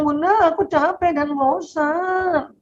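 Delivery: put-on slow, high, drawn-out child's whine; whiny tired child. Child whining to mother that he is tired and bored. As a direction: sound like a worn-out kid complaining.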